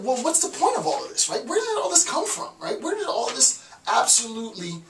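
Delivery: high, incredulous string of rhetorical questions; exasperated questioning. Retold or imitated. Voicing exasperated Nietzsche questioning it all.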